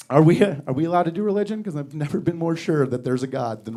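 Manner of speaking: ordinary talk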